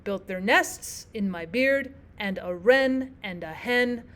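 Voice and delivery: light lead-in words, weight on the nouns nests, beard, wren, hen; anapestic stress. Shown as a demonstration. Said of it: "putting the stress on this particular".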